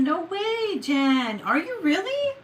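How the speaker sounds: very high, excited disbelief; real amazed reaction, rising high; delighted surprise. Real, not acted. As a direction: pitch shoots up, thrilled to find out. Surprised by viewer's heritage comment.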